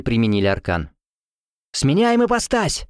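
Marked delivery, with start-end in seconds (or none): 1.7-2.9 high slow command. A narrator's reading. Karni commands the team to transform, high and slow. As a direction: order the change firmly. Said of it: "скомандовала Карни".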